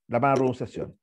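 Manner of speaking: ordinary delivery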